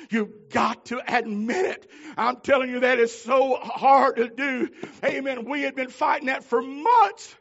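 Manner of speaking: loud, high, slow pulpit testimony; fervent preaching. Acted. Preacher confessing the struggle to his church, full-voiced. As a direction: preach it out to the congregation.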